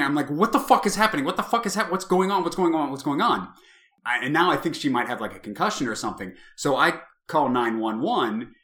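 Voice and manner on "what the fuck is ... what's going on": higher, half-scream that eases off as it repeats; mock panicked yell, tumbling down in pitch; retold panicked scream. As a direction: re-enact frantic screaming, not at full force. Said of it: "I'm, like, screaming".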